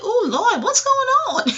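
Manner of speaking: high, loud mocking squeal of shocked church ladies; mock alarmed girlfriends. Retelling the girlfriends' shock, high mock squeal. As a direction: squeal it like the startled friends upstairs. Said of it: "And they was like".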